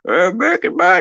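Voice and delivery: put-on high loud slow old man; grumpy grandpa voice. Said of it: "gotta get my old man voice going".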